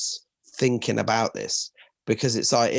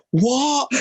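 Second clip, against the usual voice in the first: loud, high-pitched mock disbelief; aghast, pitch and volume jumping up; shocked parent; 11 semitones higher, 5 dB louder than usual. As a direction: imitate a flabbergasted parent. Retold parents shocked again.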